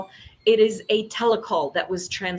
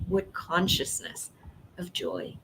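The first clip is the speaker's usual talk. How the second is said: ordinary talk, nothing marked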